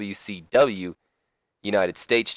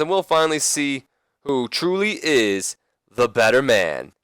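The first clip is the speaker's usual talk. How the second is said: higher, animated promo voice falling at the end; lightly acted manager hyping the showdown; Ric Flair; 5 semitones higher than usual. Manager answers the stunned wrestler, lightly acted hype. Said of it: "Flair responds".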